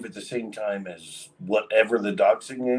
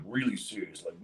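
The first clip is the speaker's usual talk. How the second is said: normal talking voice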